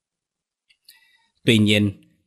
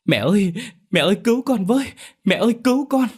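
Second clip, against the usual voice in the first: high-pitched frantic cry for help; gọi lớn; faster than usual. A narrator's reading. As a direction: call out desperately to mother, voice shooting way up. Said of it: "Anh ta gọi lớn". (gọi mẹ cầu cứu thất thanh, giọng cao vút)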